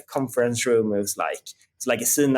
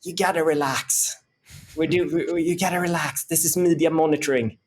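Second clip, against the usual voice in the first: put-on higher, laid-back American Australian surfer accent; 6 semitones higher than usual. Boss in American Australian accent telling frustrated employee to relax. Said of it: "American Australian accent".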